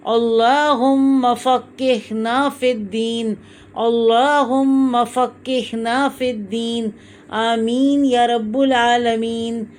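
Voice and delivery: slow, drawn-out dua recitation; measured chant-like supplication; recited Arabic prayer. Real, not acted. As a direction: recite it slowly, holding the long vowels.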